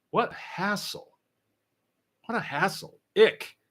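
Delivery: wrinkle up your nose at it; mock disgust. Real, not acted. Host mocking gym commitments with mock disgust.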